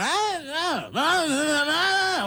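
very high wailing ah cries; mock yelling lady. Imitating the drunk lady yelling at the show: high mock wail. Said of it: "really loud".

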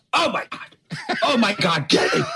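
high-pitched retold cry of alarm; mock-horrified, high-pitched exclamation; mock distressed cry. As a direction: imitate a shocked call from another room. Overhears roommate crying out in shock.

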